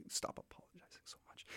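a soft unvoiced whisper to the listener; hushed jab at Canadian manners; whispered aside. Real, not acted. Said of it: "Canadian culture".

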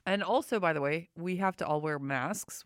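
ordinary delivery

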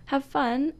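ordinary talk, nothing marked

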